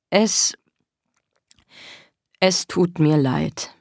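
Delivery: halting, slow, sinking; cowed apology. Acted. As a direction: apologise meekly with long pauses. Erzwungene Entschuldigung. (kleinlaut, stockend)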